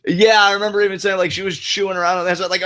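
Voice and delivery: normal talking voice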